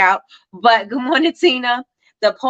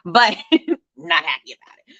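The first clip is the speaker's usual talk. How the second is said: laughing through the words. Real, not acted. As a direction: chuckle while repeating it. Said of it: "but I'm not happy about it".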